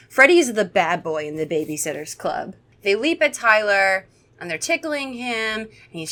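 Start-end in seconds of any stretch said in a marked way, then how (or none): none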